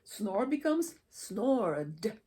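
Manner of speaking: slow "snored" ending on a voiced D, snord. Shown as a demonstration. Teacher demonstrating the D ending for the class. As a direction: say "snored" slowly with a buzzing D. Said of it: "smell becomes smelled".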